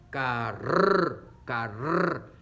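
"Carr" ending in a long rolled trill; R fuerte. Shown as a demonstration. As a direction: hold the trill at the end of "Carr". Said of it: "Suena fuerte". ("Carr" con erre final larga y vibrada)

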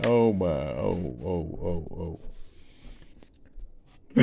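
usual speaking voice

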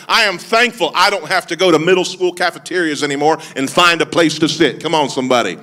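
usual speaking voice

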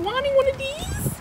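very high excited squeak; too excited. Real, not acted. Unboxing a long-wanted plant, overexcited high squeal. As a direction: let the voice shoot up with excitement. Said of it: "I'm too excited right now".

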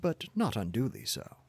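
ordinary delivery, nothing marked

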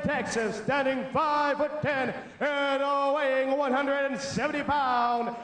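ordinary delivery

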